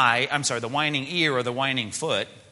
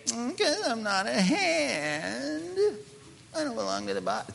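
high, drawn-out whiny foot; whining; 11 semitones higher, 3 dB quieter, slower than usual. Acted. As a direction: whine it like a sulking, self-pitying foot. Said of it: "there's the whining part of this".

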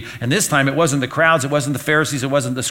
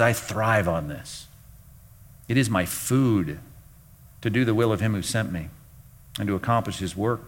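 solemn Jesus; 5 semitones lower, 6 dB quieter than usual. Retold or imitated. Jesus quoted with solemn weight.

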